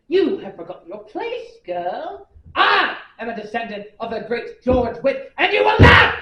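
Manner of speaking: evil queen. Acted. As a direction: arrogant rage building to a roar. Arrogant outburst at former servant.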